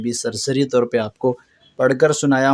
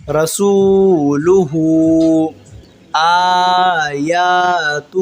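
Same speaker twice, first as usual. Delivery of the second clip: very slow drawn-out recitation; 4 dB louder, much slower than usual. Shown as a demonstration. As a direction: stretch every syllable like a tajweed teacher. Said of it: "جی اسی طرح پڑھنا ہے اس کو". (آہستہ کھینچ کر تلاوت)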